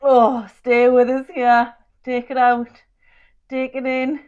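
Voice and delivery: strained and shaking mid-plank; shaky voice. Real, not acted. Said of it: "You can hear my voice is shaking so much".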